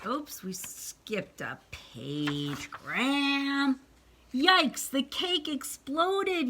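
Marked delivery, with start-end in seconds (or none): 4.3-6.5 high, slow startled yelp. A narrator's reading. Father yelping as the cake explodes, high. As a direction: jump back in alarm. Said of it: "yelped Froggy's father".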